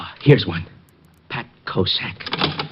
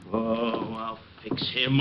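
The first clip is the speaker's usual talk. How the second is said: muttered threat; 2 semitones lower, 11 dB quieter than usual. Acted.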